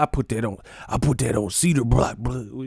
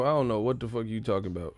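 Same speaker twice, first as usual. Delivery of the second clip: usual speaking voice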